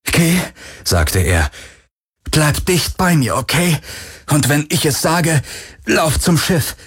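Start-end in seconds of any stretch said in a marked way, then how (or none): 0.0-0.4 high, tense command; alarmed, raised pitch; urgent order. A narrator's reading. Urging flight amid an invisible attack. 2.3-6.8 high, tense voice of alarm; pressing, frightened higher pitch; urgent instructions. A narrator's reading. Urging companion to flee under attack.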